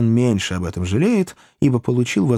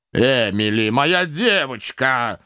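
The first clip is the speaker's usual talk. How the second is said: reproachful; 3 semitones higher, slower than usual. A narrator's reading. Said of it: "укоризненно сказал мистер Ордль".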